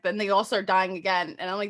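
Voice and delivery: ordinary talk, nothing marked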